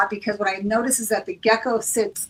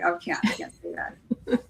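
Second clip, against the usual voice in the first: mock whispered hesitation; 3 semitones lower, 5 dB quieter than usual. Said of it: "it's like".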